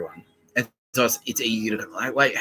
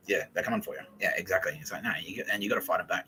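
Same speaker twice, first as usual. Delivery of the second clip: ordinary talk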